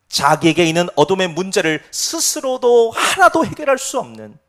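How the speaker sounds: normal talking voice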